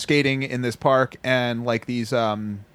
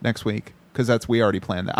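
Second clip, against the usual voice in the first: ordinary delivery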